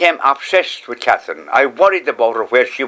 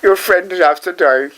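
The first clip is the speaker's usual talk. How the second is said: put-on old man repeats the nun's phone news, higher and grieving; echoing the news, higher and stunned; 8 semitones higher than usual. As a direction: repeat the words higher, struck by grief. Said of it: "She said that to me".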